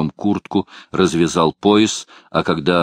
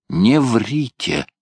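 ordinary delivery

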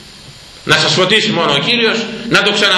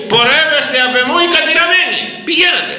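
high, ringing judge's pronouncement; the Lord's condemnation; 11 semitones higher than usual. Acted. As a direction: cast out the damned with force. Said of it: "Είδατε τι τους λέει". (αυστηρή, υψωμένη φωνή καταδίκης)

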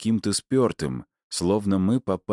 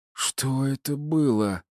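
hoarse; 8 semitones higher, slower than usual. A narrator's reading. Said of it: "прохрипела Кейт".

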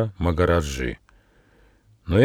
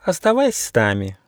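high, coy little mouse; coy invitation; 5 semitones higher than usual. Acted. Girl mouse shyly invites Gosha to stay, coy. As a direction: shyly ask him to stay, pitched up. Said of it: "потупила глазки Лили".

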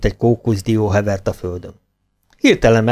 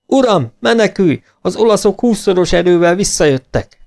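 higher, urgent cry to flee; urgent warning; 8 semitones higher than usual. A narrator's reading. Servant bursting in to warn his master, urgent cry. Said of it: "Ali berohant".

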